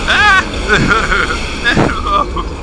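panicked high screams; being chased. Real, not acted. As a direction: shriek in panic, voice cracking up. Screaming while enemies rush him.

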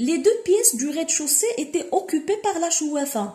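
slow, careful reading of a literary passage; reading aloud. Shown as a demonstration. Teacher reading the novel to students, slow and deliberate. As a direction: read each word slowly for the class. Teacher reads the French text.

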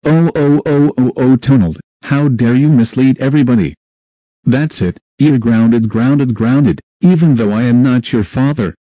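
loud furious telling-off, grounded over and over; angry scolding. Acted. The party host scolding Donald for misleading everybody, loud and furious. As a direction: blow up at him and ground him.

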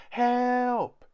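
very high, loud scream for help; a terrified small boy crying out; real panicked yell. A narrator's reading. Kipper screaming for help, very high and loud. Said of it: "yelled Kipper".

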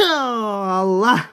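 loud sneeze then Allah; sharp real sneeze; sneezing. Real, not acted. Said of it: "videodayken de hapşurdum ya".

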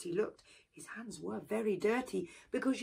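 usual speaking voice

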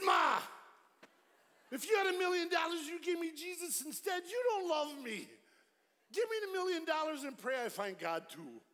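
hammed-up son arguing for the money; mock protest. Retold comic protest to dying mother.

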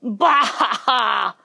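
hammed-up villain's laugh in separate beats; mocking laugh. A narrator's reading. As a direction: laugh scornfully as the twister. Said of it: "laughed the twister".

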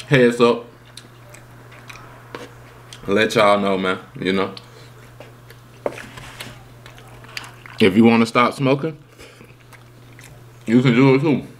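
talking while eating; chewing between words; mouth full, chewing. Real, not acted. Seen 0: Forkful into mouth at 4.6; chewing visible throughout the words.

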